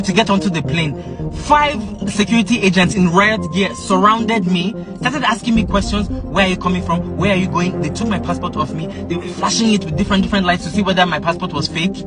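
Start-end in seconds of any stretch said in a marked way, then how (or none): none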